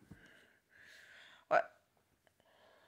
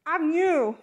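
normal talking voice

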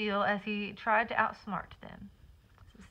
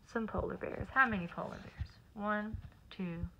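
soft counting aside; 7 dB quieter than usual. Real, not acted. Counting with child.

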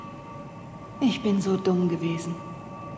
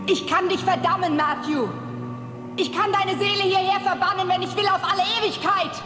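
menacing, higher pitched threat; high threatening; 9 semitones higher than usual. Acted. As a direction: threaten damnation. Demon threatens damnation. (drohend, mit hoher Stimme)

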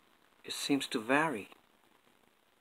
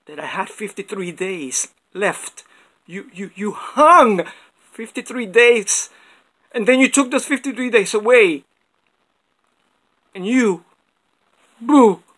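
building from raised voice to full high shout; furious outburst; 8 semitones higher, 18 dB louder than usual. Acted. Prisoner to the court over fifty-three days taken away, furious shouting.

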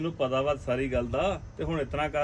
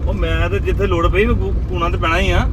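higher, loud, stubborn protest; loud insistence; 4 semitones higher, 12 dB louder than usual. Acted. Husband insisting he will interfere, high loud protest. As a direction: push back, voice raised high.

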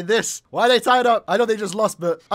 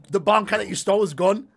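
ordinary talk, nothing marked

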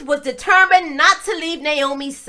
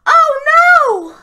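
mock dismay; 10 semitones higher, 5 dB louder than usual. Narrator reacts to sons' deaths.

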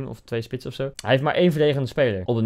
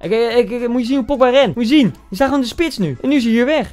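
high, loud disbelief; can you believe this, up high and loud; exasperated rant; 9 semitones higher, 7 dB louder than usual. Real, not acted. Ergert zich aan Pogba. (hoog en luid, ongelovig geërgerd)

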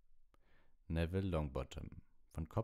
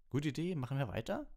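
put-on high, eager beaming boy; 11 semitones higher, 4 dB louder than usual. A narrator's reading. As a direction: ask it brightly, pitched high. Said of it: "fragte Fred Weasley strahlend".

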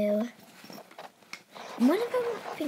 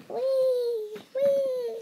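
high excited whee; 10 semitones higher, 4 dB louder than usual. Acted. Toy car ride.

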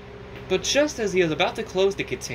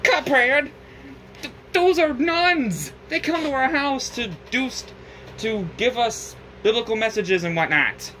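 much higher, exasperated scold; 9 semitones higher than usual. Acted. Elder Kettle scolding Cuphead about the nuns, high exasperated. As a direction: scold him in disbelief.

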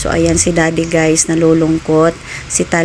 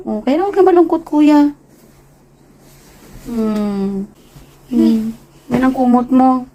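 high soothing mother; 4 semitones higher than usual. Real, not acted. Mother comforts son.

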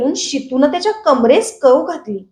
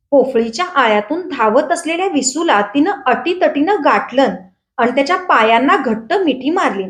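plain reading voice

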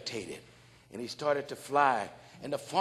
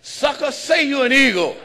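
louder, half laughing order; loud laughing command; 8 semitones higher, 11 dB louder than usual. Retold or imitated. The man loudly ordering the bird to say it. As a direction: push him hard, grinning.

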